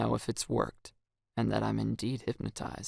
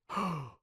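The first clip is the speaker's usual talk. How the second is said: gasp; 6 semitones higher, 6 dB quieter than usual. Acted. Shocked reaction.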